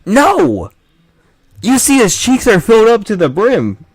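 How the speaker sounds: high, loud comic rant; hammed-up exasperation at full volume; outraged yell. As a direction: shout it in mock horror. Rant at overfed cheeks peaks.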